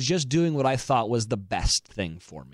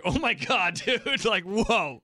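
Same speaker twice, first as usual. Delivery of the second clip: higher-pitched mock astonishment; imitating shocked sister; 7 semitones higher, slower than usual. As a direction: play the sister taken aback. Sister's shocked reaction acted out.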